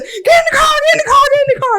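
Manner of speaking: high, loud, urgent repeated yell; screaming. Retold or imitated. Fleeing the cop, screaming at the girl. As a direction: re-enact screaming an order, pitch way up. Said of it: "He heard me screaming at this girl".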